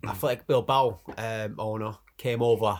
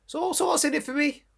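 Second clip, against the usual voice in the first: mock high voice. Mock sacrifice victim, high pitch.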